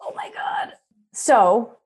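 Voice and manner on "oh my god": shocked cry. Real, not acted. Reacts to ZzzQuil question.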